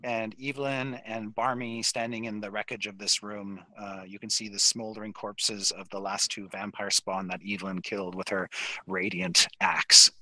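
hushed, soft narration; game master's scene setting. Acted. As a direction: set a grim scene quietly. Game master sets a grim scene.

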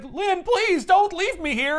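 pleading. Acted. Wild on the operating table begging Lynn, high and slow. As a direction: beg her not to go, drawn out. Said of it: "he's like".

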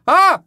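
high, loud mock scream. Showing how a scream makes you faint, mock scream. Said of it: "Es en plan".

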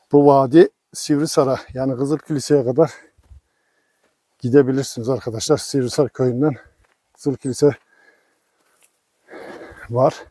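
usual speaking voice

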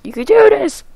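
high, squeaky self-encouragement; high cheering. Real, not acted. Gamer urging hobo man across the rope, high cheering. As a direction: push it way up high to urge yourself on.

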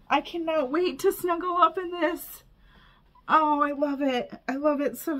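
high-pitched, overwhelmed gushing; gush, pitch lifted, close to tears; emotional. Real, not acted. Thanking a friend for a crocheted gift, overcome with emotion. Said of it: "I don't wanna get too emotional".

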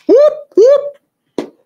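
loud, very high whoops; two whooping siren bursts; playful piercing siren call; imitated police siren.

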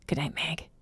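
hushed and affectionate; tender goodnight. A narrator's reading. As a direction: sign off gently, almost under the breath. Boyfriend's tender goodnight on phone.

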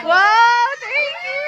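high, loud squeal of delight. Real, not acted. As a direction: shriek with joy over the gift. Receiving the gift. (tili sa tuwa sa regalo)

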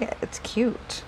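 breathy whisper to herself. Real, not acted. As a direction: whisper it as a private aside. Quiet aside about page.

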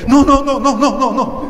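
hammed-up frightened woman backing away; panicked refusal. (negativa asustada e imitada, más aguda y fuerte)

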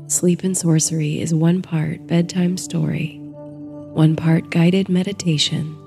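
ordinary delivery

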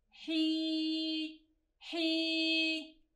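حي said soft with a long drawn-out ي; long vowel sound. Shown as a demonstration. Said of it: "ومع مد الياء". (حي بصوت خافت مع مدّ الياء طويلاً)

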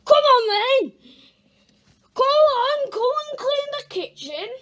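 loud, high yelling parent. Acted. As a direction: bark the order loudly.

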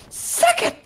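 very high acted yell; Jill impression. Quoting a game heroine taunting the monster, high defiant yell. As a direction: throw the taunt out high and sharp. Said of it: "Jill saying for, uh, to Nemesis".

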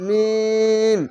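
মিম with a very long, level ee; chant-like, drawn-out মিম; three-alif stretch. Shown as a demonstration. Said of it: "তিন আলিফ টেনে পড়বেন".

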